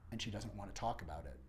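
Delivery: ordinary delivery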